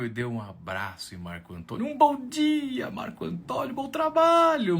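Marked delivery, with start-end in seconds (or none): 1.9-4.8 gushing good wishes. Acted. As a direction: fawn over him. Doorman wishes him well.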